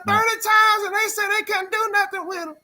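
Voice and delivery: high, loud, pleading mother's voice. Retold or imitated. Imitating the mother on the news, high and loud. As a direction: cry it out like the desperate mother. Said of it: "What was that woman doing".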